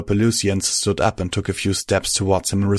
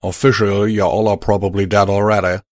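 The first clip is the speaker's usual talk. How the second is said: loud, firm Southern cowboy, sorry underneath; 4 dB louder than usual. Acted. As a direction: break the news plainly, with regret. Calamity continues same voice.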